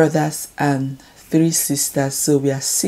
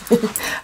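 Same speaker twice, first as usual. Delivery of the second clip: embarrassed giggle; 7 semitones higher, 4 dB quieter than usual. Real, not acted. Embarrassed about a bad habit.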